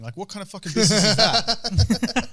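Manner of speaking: incredulous question dissolving into laughs; laughing incredulity. Real, not acted. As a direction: laugh through the disbelief. Laughing at the retold scam.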